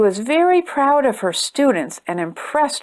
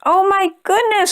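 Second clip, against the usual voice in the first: high, delighted gasp of wonder; a child seeing something magical; oh my goodness, bright and high; amazed cry; 9 semitones higher than usual. A narrator's reading. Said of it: "cried Doodle".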